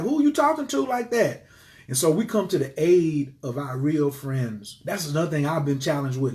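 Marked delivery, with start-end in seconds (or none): 0.0-1.4 high, sharp mock confrontation; a touch of outrage defending a friend; indignant callout. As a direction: challenge them, retold with heat. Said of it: "They was like".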